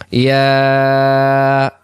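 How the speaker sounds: ইয়া held out long, three alif stretch; long drawn ya. Shown as a demonstration. Teacher showing students the three-alif stretch on ইয়া. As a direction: pull the ইয়া out slowly for three beats. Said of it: "সবাই টান দেন".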